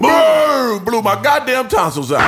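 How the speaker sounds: explosive high shout, acting out a gunshot; shouted blast. Imagining the shot through his door, shouted dramatic blast. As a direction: boom it out loud and high, like the blast itself.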